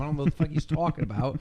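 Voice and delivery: usual speaking voice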